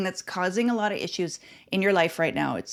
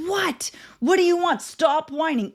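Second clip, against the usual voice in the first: mock-exasperated parent, pitched high; a parent fed up with whining, snapping back; irritated parent; 7 semitones higher than usual. Mother imitating a parent snapping at a whining child, exasperated.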